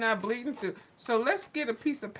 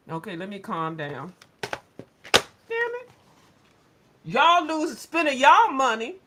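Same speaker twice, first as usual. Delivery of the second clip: ordinary talk, nothing marked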